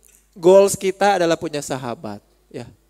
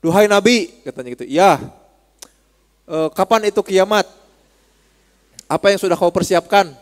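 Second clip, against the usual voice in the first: louder calling to the Prophet; Bedouin calling out; 6 dB louder than usual. Retold or imitated. Bedouin calling out to the Prophet, loud. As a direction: call out across the crowd. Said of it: "Orang Badui nanya ke Rasul".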